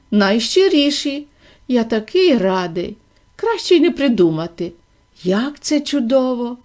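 usual speaking voice

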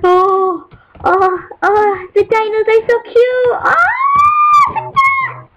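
high squealing delight; real high-pitched cooing squeal; gushing over cute. Real, not acted. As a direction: melt over something adorable.

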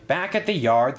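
ordinary delivery

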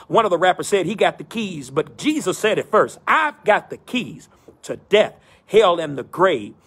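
rising preacher cadence, building phrase by phrase; preaching. Acted. Pastor preaching to his virtual church, in a rolling preacher cadence. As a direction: preach it in a rolling call and response rhythm.

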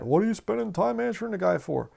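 a touch of whiny objection; imitating upset brethren.

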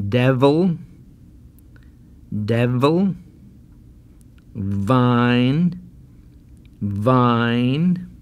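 words said at normal speed, V still buzzed clearly. Shown as a demonstration. Teacher gives normal-speed words to repeat. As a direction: say each word briskly, V crisp. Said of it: "And now a little faster".

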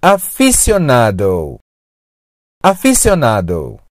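aficionado with an S sound, a-fi-syo-NA-do; low, clear aficionado with S not SH; first pronunciation variant. Shown as a demonstration. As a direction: model aficionado for repeating, "sy" in the middle. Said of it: "Aficionado pode ser pronunciado".

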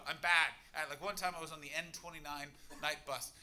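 ordinary talk, nothing marked

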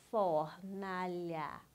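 "Fornalha" slow and evenly drawn out in three syllables. Shown as a demonstration. Teacher helping pupil count syllables, syllable by syllable. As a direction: sound out "Fornalha" slowly, letting each syllable stretch. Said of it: "separe as sílabas".

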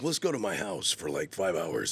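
lightly voiced creepy trailer guy; a hint of sinister invitation; evil trailer voice. Don LaFontaine inviting him home, lightly evil. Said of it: "Yeah, evil kind of".

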